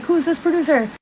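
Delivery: high-pitched demand without extra volume; imitated jealous questioning. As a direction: mimic a suspicious partner demanding an answer. Retold quarrel, jealous demand.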